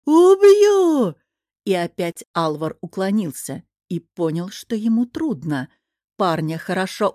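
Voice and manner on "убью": high, very loud battle yell; full-voiced scream of rage; enraged roar. A narrator's reading. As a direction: roar it out, stretched and furious. Enraged fighter attacks again.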